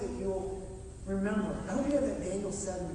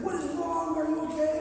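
higher, louder imitation of an alarmed wife; worried wife; 9 semitones higher, 7 dB louder than usual. Wife calls to husband on the ladder, acted alarm. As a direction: call up to him as a concerned wife. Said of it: "And his wife's like".

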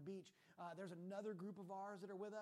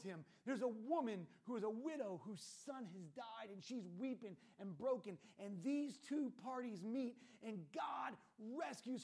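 louder, emphatic sermon delivery with stretched key words; forceful preaching; 3 semitones higher, 8 dB louder than usual. Real, not acted. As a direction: drive the story home to the congregation. Preaches the story at full force.

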